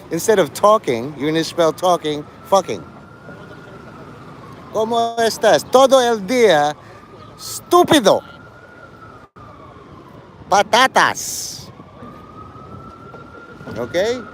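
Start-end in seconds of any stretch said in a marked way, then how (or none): 4.7-8.2 hammed-up Spanish phrases, higher pitch; mock Spanish. Streamer mocking a chatter in a mock Spanish accent. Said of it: "that's how you spell, uh, Spanish". 10.5-11.7 Patatas drawn out slowly in a put-on Spanish accent; mock Spanish. Streamer teasing chat with a slow mock Spanish Patatas. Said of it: "that's how you spell, uh, Spanish".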